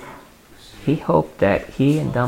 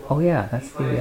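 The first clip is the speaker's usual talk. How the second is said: ordinary delivery, nothing marked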